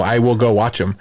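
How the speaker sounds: ordinary talk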